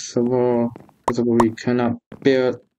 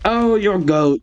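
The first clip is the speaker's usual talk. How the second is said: excited praise; 7 semitones higher than usual. Real, not acted.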